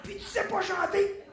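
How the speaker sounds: high, loud incredulous question; hammed-up disbelief, called out loudly; imitated singer's disbelief. Singer discovering the dancer cannot sing, incredulous. [name] catches him unable to sing.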